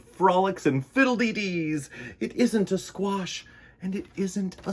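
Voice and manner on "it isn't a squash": ordinary delivery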